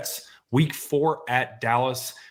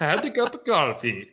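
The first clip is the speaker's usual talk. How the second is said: higher, badly faked British accent; terrible British accent; 5 semitones higher than usual. Co-host retries his failed accent for laughs, badly faked British. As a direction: botch a British accent so it drifts off target. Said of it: "tried to do a British accent".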